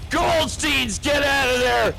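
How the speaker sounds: high-pitched panicked yelling of a warning; frantic warning shout. Retold or imitated. A comic warns the Goldsteins of danger, yelling high and frantic. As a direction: shout at them to flee like the house is on fire. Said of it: "I'm like".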